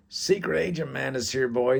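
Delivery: high, loud hail to a friend; acted rowdy welcome; boisterous greeting. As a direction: greet your buddy across the bar.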